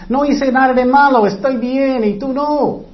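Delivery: put-on sass it like a child denying blame; childish defiance. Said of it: "como los niños".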